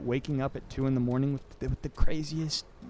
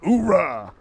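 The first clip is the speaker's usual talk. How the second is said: barked military oorah; loud gung-ho soldier; 4 semitones higher, 6 dB louder than usual. Retold or imitated. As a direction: shout it like a hyped marine. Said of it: "I imagine you're just saying".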